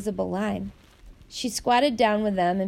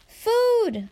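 high excited cry of joy; light up at the sight of food; delighted; 13 semitones higher, 4 dB louder than usual. A narrator's reading. Hungry child delighted the guard brought food. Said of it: "Elias said delightedly".

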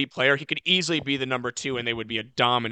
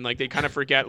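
ordinary talk, nothing marked

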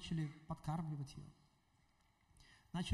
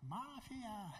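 put-on drunken yelling; 6 semitones higher, 7 dB quieter than usual. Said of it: "и кричит".

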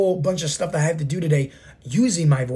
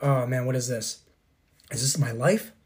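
low, hushed reaction to a letdown; understated, sinking disbelief; mild dismay; 4 semitones lower, 5 dB quieter than usual. Acted. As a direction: drop softer and lower, quietly dismayed. Watching own life film, dismayed.

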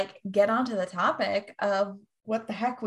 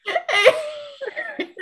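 shrieking burst of laughter; high squeal laugh; 5 dB louder than usual. Real, not acted. Laughing at the joke.

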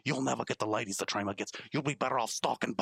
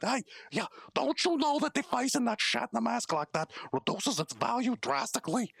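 ordinary talk

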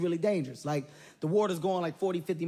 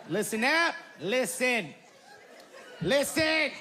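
imitating the rafting guide; mock rafting guide; 6 semitones higher, slower than usual. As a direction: call the group in like a bossy guide. Guide calling group to attention.

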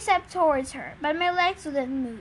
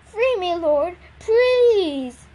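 higher, louder plea with a drawn-out please; pleading; 4 semitones higher, 7 dB louder, much slower than usual. A narrator's reading. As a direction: beg to be set free, stretching the last word. Caged zebra begs to be freed.

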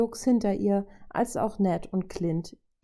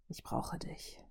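whispered, very soft; breathed realisation; 14 dB quieter, slower than usual. A narrator's reading. Robot realising he needs her, breathed whisper. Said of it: "hauchte er voller Erkenntnis".

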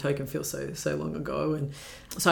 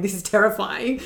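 higher, louder mock alarm; retold alarm; 5 semitones higher, 5 dB louder than usual. Retelling her friend's fright on the bikes, mildly alarmed voice. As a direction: re-enact a friend's alarm, pitched up.

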